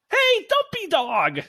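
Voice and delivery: put-on high, slow girlish call; a kid calling to the mascot; Madison calling out. Young Madison calls out to the mascot, high and slow. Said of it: "says Madison".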